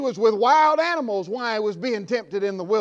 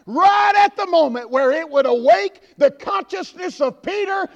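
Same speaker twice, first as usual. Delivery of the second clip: rising, louder and higher revival preaching, shouted at the end; full-force preaching; 6 semitones higher, 6 dB louder than usual. Acted. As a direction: build the sermon into a fiery shout. Preaching surge.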